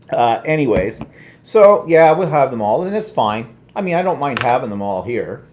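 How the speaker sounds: ordinary delivery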